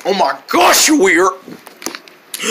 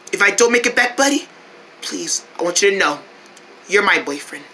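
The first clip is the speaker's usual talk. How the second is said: tender; 5 semitones lower, 5 dB quieter, faster than usual. Acted. Tender farewell before danger.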